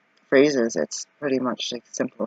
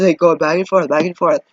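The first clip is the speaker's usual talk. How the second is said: usual speaking voice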